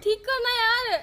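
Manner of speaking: high, drawn-out whine; slow, pleading whine; whining plea. Real, not acted. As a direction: beg him, stretching the words slowly. Begging him to fix the phone.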